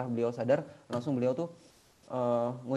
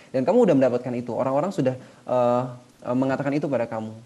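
louder, stern divine judgment; stern verdict; 6 dB louder than usual. Acted. God condemning the man who gave for fame, loud and stern. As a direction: pronounce the sentence with force. God judges the false martyr.

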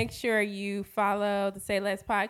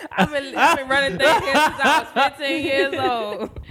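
laughing through the words; loud, laughing line; 6 semitones higher, 9 dB louder than usual. Real, not acted.

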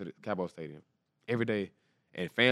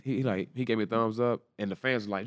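usual speaking voice

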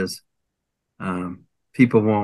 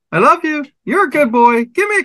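very high squawky parrot imitation; high parrot mimicry of a sweet-talking bird; 4 dB louder, faster than usual. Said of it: "And the bird went".

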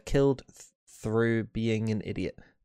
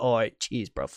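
put-on London roadman accent; slower than usual. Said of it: "He'll be like".

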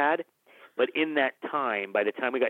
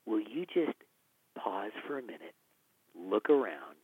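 gentle rebuke; 2 semitones lower, 7 dB quieter than usual. Retold or imitated. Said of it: "he was like".

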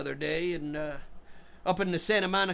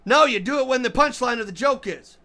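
raised, louder insistence; exasperated correction; 6 semitones higher, 7 dB louder than usual. Acted. Comedian setting the drummer straight on punchlines, exasperated. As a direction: correct him loudly, at the end of patience.

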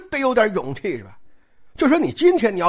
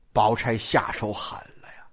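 lower, breathy secret aside; conspiratorial whisper; 10 semitones lower, 6 dB quieter than usual. Acted. Storyteller confiding to listeners in a whisper. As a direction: lean in and let them in on it.

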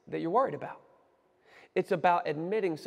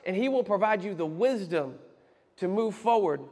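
ordinary talk

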